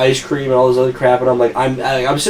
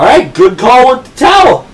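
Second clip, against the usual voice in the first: sarcastic cheer; 11 semitones higher, 7 dB louder than usual. Retold or imitated. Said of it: "I was like".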